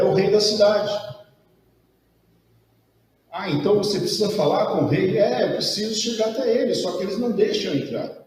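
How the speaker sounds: put-on high, pleading young boy; a kid finally opening up; boy's voice. Boy telling the stranger he must see the king. Boy explains his problem.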